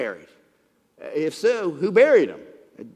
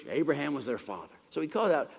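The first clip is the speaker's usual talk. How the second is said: ordinary talk, nothing marked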